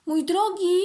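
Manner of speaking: high, loud hail from a little ant; calling out. A narrator's reading. Ant spots a butterfly overhead, calling out. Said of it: "zawołała".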